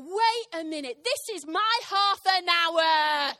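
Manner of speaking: loud, indignant shout with drawn-out words; retold anger, hammed-up and slow; angry outburst. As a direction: stretch out HALF AN HOUR in outrage. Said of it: "Because I was angry".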